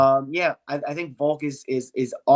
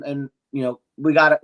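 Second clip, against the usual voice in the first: normal talking voice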